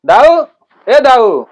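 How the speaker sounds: loud call across the yard. Acted. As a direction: call out to the old man. Calling out to elder.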